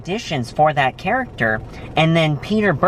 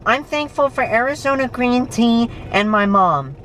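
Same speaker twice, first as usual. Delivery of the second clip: a lightly voiced little boy, higher; a hint of a small child, higher; 9 semitones higher than usual. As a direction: play your younger self answering sweetly. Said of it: "me being a little boy".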